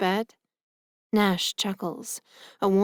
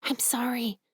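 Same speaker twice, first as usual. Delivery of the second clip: higher apologetic whisper; 5 semitones higher, 4 dB quieter than usual. A narrator's reading. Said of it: "I whisper back".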